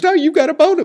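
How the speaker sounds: high, loud mock protest; imitated teasing retort. Retelling the laughing friend correcting him, teasing retort.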